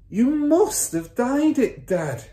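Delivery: put-on high, loud boyish insistence; son's voice. A narrator's reading. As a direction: insist to Dad, stretching it out. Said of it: "the son said".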